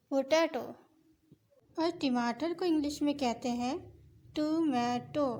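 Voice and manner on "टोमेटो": usual speaking voice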